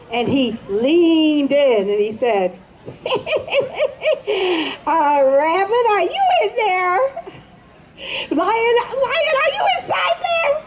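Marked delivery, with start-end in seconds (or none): 2.9-4.8 a soft high snicker; sly chuckle. Acted. Rabbit laughs at trapped lion. 4.8-10.5 high, fast mocking sing-song; mock concern. Rabbit mockingly calls the lion.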